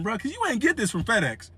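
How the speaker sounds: ordinary talk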